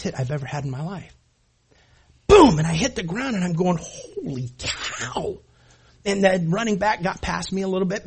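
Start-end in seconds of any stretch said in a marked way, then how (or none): 2.3-2.6 loud, sharp mock crash of a tackle; imitated boom. Imitating the hardest hit. 3.8-5.4 dazed. Retold or imitated. Dazed after the hardest hit.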